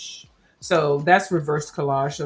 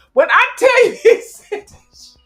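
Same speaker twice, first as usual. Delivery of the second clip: high laughing exclamation; 10 dB louder than usual. Real, not acted. Laughing recounting funny moment.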